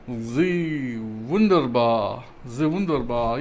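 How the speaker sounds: slow, deep mock German accent. Shown as a demonstration. Vlogger hamming up a German accent over thermal paste. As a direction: lay on a hammy German accent. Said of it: "I can't do my German accent".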